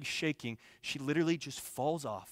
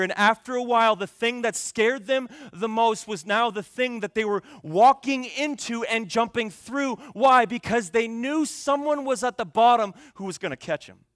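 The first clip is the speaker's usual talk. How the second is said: loud, raised preaching voice; impassioned preaching; 8 semitones higher, 10 dB louder than usual. Real, not acted. Preacher on children jumping to be caught, loud and impassioned. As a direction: drive the story home at full force.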